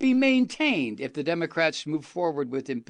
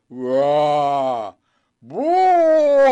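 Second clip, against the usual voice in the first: puffed-up roar; 10 dB louder than usual. Retold or imitated. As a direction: puff up and bellow like a bully. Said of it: "like the Cheshire Cat".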